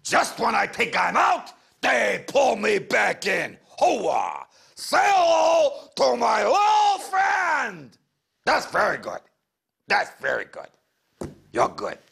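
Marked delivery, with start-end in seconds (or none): 0.0-8.0 hammed-up gangster movie lines, shouted; movie star impression. Hypnotised presenter turns into a shouting movie star, hammed-up. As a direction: bellow it like a tough-guy film star. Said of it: "think of your favourite movie star".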